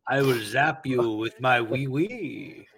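slow mock-French robot threat; drawn-out, silly French-accented machine; French Cyberman. French Cyberman threatening to zap, comic robot voice. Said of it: "he's the French Cyberman".

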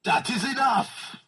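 high, drawn-out theatrical cry; mock commanding shout. Riffer voicing the film's outburst, mock shout. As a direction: call a halt in a slow, high-pitched mock roar. Acts out a film character's outburst.

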